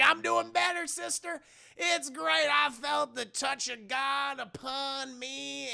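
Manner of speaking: high-pitched beatific testimony; mock religious rapture. Caleb reassures sister he felt God, mock rapture. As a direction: testify like a blissfully revived boy.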